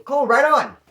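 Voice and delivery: loud, high pleased exclamation. Retold or imitated. Getting the headphones, a loud, happy cool right on. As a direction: cheer like the deal just landed.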